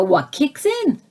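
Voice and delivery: ordinary talk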